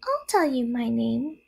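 high, small spider voice; little spider. A narrator's reading. First spider bargains with Wilbur, small voice. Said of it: "replied the first little spider".

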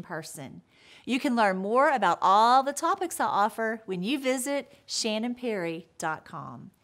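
low, measured announcement voice; show promo read. Acted. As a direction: deliver a polished sign-off, pitched well below stage talk. Recorded promo and sign-off to viewers.

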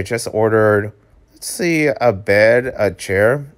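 ordinary delivery

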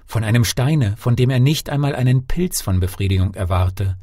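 plain reading voice

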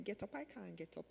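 hushed, doubtful objection; quiet protest. Retold or imitated. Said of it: "And I'm saying".

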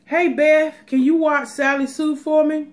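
high, loud put-on Southern accent; high-pitched mock Southern voice. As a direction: ham up a friend asking a favor. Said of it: "I just love to do accents".

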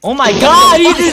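loud, high hype yell; excited shout. Real, not acted. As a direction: scream with disbelief at a great play. Hype over teammate's play.

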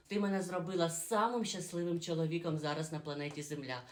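ordinary delivery

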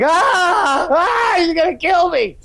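high hammed-up screaming cry; imitating a kid begging in the back seat; mock terrified kid. Mother mimics her kid shrieking in fear. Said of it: "where they're like".

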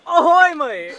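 loud high teasing hello; mock loud greeting. Teasing a friend caught texting, loud mock greeting. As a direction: call out loudly to catch him out.

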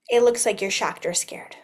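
gasp, then a scared hush; shocked gasp. Shown as a demonstration. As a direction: show shock with a sharp intake of breath. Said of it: "If you're shocked".

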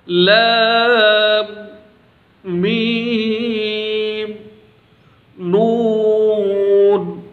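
لام, میم and نون held very long; slow letter recitation; drawn-out letters. Shown as a demonstration. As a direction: stretch each letter slowly. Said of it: "جیسا کہ میں آپ کو پڑھ کر سناتا ہوں".

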